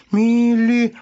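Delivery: МИЛИ high, loud and stretched; sounding out syllables. A narrator's reading. Hare reading the rag, МИЛИ drawn out high. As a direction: labour through МИЛИ slowly. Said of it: "стал читать по складам тряпочку".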